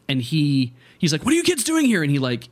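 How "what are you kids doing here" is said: high-pitched imitated scolding; mock indignant grown-up challenge; scolding worker. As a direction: shoo the kids off, voice pitched way up. Imitates construction worker scolding kids.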